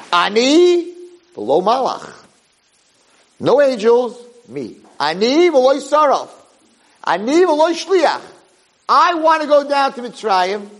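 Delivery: high, ringing proclamation; emphatic declaration. Retold or imitated. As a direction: declare it as God to the angels. Voicing God's declaration to angels.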